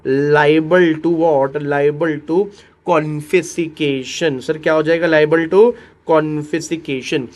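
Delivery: very slow, word by word; teacher drilling liable to confiscation; slow drilled phrase. Shown as a demonstration. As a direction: drill the phrase slowly for students. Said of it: "ये definitely बोलो क्या होएगा?".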